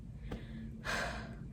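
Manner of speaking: long unvoiced exhale; heavy breathless sigh. Real, not acted. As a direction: let out a tired breath. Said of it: "I just got out of breath".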